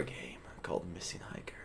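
hushed spooky whisper for the title. Acted. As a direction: whisper it like a secret. Reading the game title aloud.

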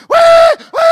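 piercing high, drawn-out pig squeal; imitated hog squeal. Billy imitates the shot hog spinning, shrill loud squealing. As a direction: squeal loudly like a dying hog. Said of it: "it sounds like it's gonna die".

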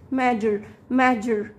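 teacher modelling "ماجر" for measure; zh sound. Shown as a demonstration. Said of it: "الاس يو هنا هتديك حرف الج".

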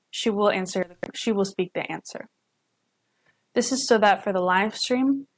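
usual speaking voice

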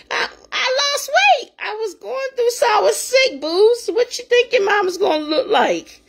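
indignant retort. Retold or imitated. A mother retorting to her children about her weight, indignant and high.

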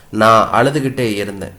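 usual speaking voice

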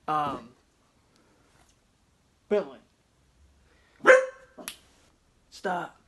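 loud firm call to the dog; scolding the dog. Real, not acted. Owner shouting at his dog Bentley to stop, loud and firm.

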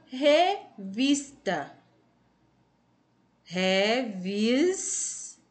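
revista slowly split, with a drawn-out vis; syllable by syllable. Shown as a demonstration. Teacher quizzing pupils on revista, syllable by syllable. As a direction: sound out revista in pieces, holding the vis. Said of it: "é a sílaba mais forte".

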